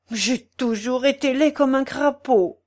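mild, understated despair. A narrator's reading. Sick man confessing to his portress, mildly despairing. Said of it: "dit Pons au désespoir".

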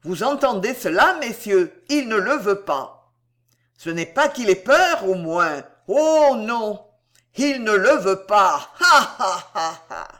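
high, loud jeering to onlookers; mock him publicly, ending in an acted laugh; exaggerated sarcasm with a scornful ha-ha; sarcastic taunting. A narrator's reading. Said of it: "ému par ce sarcasme".

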